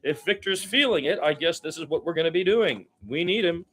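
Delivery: lively live game commentary, pitch jumping with the action; play-by-play call. Acted. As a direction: call the game as it happens for the listeners.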